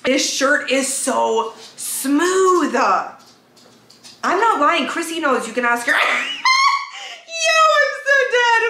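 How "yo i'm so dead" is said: high squealing laugh into the words; laughing hard. Real, not acted. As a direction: crack up, barely get it out. Cracks up at her own bit.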